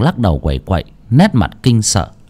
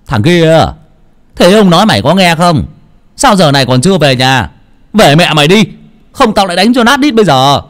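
loud, higher scolding yell; angry shout; 8 semitones higher, 5 dB louder than usual. A narrator's reading. Uncle Mộc scolds the boy at the temple, angry shout. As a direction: roar at the boy to send him home. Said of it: "Bác Mộc lại quát".

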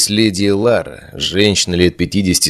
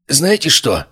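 higher-pitched, strained croak; 7 semitones higher, slower than usual. A narrator's reading. Erik to his friends, throat sore from choking, croaking. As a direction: croak it out in a raised voice. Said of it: "прохрипел Эрик".

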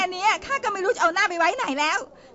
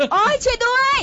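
cry for help; 7 semitones higher, 5 dB louder than usual. Acted. Woman screaming for help.

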